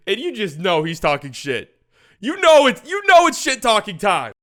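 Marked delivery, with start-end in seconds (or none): 2.2-4.3 loud, high, worked-up shout; excited hype shout. Real, not acted. Hyping Luka's shit talking, excited shout. Hyped over trash talk moment.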